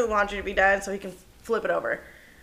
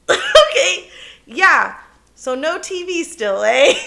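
laughing; 7 semitones higher, 6 dB louder, slower than usual. Real, not acted.

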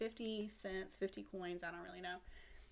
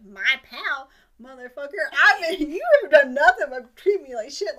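loud, higher, mock-outraged cricket; hammed-up offended outburst; indignant retold rebuke; 5 semitones higher, 23 dB louder than usual. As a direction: tell Pinocchio off at full volume, half laughing. Cricket angrily rebukes Pinocchio.

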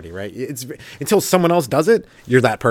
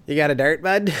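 asking for a dart; 4 semitones higher than usual. Acted.

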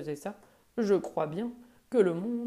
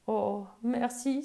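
slow, higher, heartfelt thank-you; grateful thanks; 4 semitones higher, 5 dB louder, slower than usual. Acted. The little monkey thanks the old buffalo, slow and grateful. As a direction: pour out relief and thanks. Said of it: "s'écria le petit singe, plein de gratitude".

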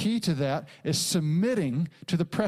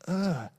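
whispered groan of shame. Retold or imitated. Confessing shame to the Lord.

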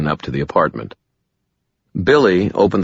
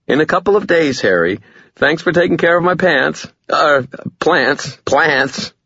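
higher female voice on phone; brisk woman leaving a message; Murphy; 11 semitones higher, 3 dB louder than usual. A narrator's reading.

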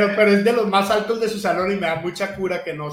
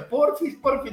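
put-on child's pleading; 5 semitones higher, slower than usual. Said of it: "me hace chantaje".

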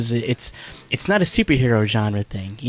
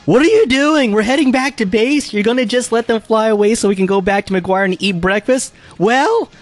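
mock scolding Lady J; 8 semitones higher than usual. Reading the last panel, Lady J scolds pilots in a high nag.

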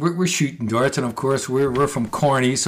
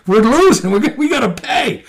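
panicked; 11 semitones higher, 5 dB louder than usual. Retold or imitated. Panicking about losing.

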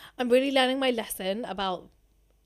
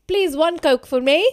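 polite ask, louder and slowed down; sweetly ordering; 5 semitones higher, 9 dB louder than usual. Retold or imitated. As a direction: put on a sweet, drawn-out order. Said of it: "I kept being like".

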